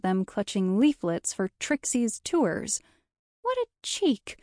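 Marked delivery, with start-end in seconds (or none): 3.4-4.4 much higher, affronted exclamation; indignant. A narrator's reading. Guide spots rival's leaflets among her customers, indignant. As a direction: exclaim it high-pitched, as an affront.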